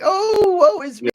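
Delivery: high-pitched mock wail; exaggerated, put-on boo-hoo lament; mock crying. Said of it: "she's crying like".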